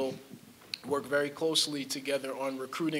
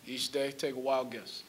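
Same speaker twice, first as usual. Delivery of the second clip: ordinary delivery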